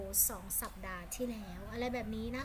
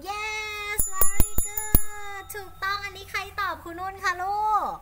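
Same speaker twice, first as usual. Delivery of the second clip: higher and louder, bursting with delight; delighted praise; 10 semitones higher, 13 dB louder than usual. Real, not acted. Teacher to her pupil after a right answer, thrilled praise. Praising student's correct translation.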